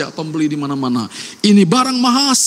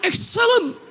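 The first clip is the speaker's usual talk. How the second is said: proud shout; 13 semitones higher than usual. Acted. Weaver praising his own work.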